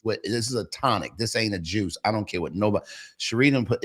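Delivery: ordinary delivery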